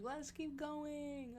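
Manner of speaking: high, slow, drawn-out cheer; carefree imitation. Injured friend wanting to carry on, carefree imitation. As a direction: stretch it out, unbothered.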